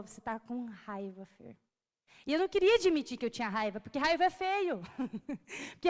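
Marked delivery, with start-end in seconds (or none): 0.0-1.6 slow, much softer, lower voice; hushed voice of the Spirit naming the anger; gentle inner voice. Retold or imitated. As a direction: speak it low and slow like a quiet divine nudge. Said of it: "ele me falou".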